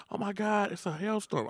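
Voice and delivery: high-pitched mock panic; imitating panicked mom. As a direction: play a worried mother on the phone. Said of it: "My mom called me like".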